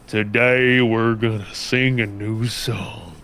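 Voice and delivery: put-on slow, drawn-out country accent. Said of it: "lead singer of the Proto Man".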